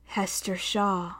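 soft, slow, caressing machine hiss; gas leak hiss. A narrator's reading. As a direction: stroke the name with a hiss. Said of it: "caressing her name with its gas leak hiss".

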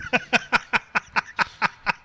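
high burst of laughter. Real, not acted. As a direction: cracks up at his own joke. Laughing at own trailer bit.

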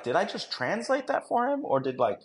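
high-pitched, incredulous questioning; baffled disbelief. Retold or imitated. Handler doubting his own ears, retold in high disbelief. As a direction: squeak it out in mock bewilderment.

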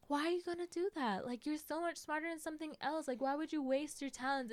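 a hint of a disapproving parent; nagging parents. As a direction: mimic a parent scolding, a bit high. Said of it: "your parents keep constantly telling you".

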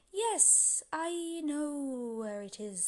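big booming wind voice, high start; a mighty north wind speaking slowly. Acted.

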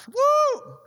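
high joyful woo; excited whoop. Acted. Preacher cheering as God arrives. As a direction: cheer like the crowd at a game.

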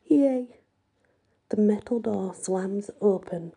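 plain reading voice

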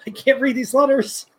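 lightly voiced, high-pitched complaint; exasperated movie character. Commentator voices character looking at Russian letters, high mock dismay. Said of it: "He's like".

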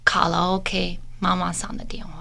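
slow hushed whisper, almost no voice; whispered confession. Retold or imitated. Telling her husband on the phone, slow breathy whisper. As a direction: whisper it like a guilty secret.

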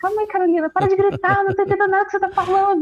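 high-pitched imitation of a confused mother; bewildered mother. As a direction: play mum trying to calm a screaming daughter. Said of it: "minha mãe não entendia nada".

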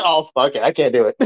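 ordinary talk

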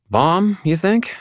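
high, drawn-out questioning tone; lost for words. A narrator's reading. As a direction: float it slowly upward, not knowing what else to say. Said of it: "in that tone people use when they don't know".

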